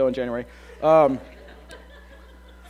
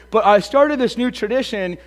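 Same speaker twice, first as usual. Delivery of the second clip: ordinary talk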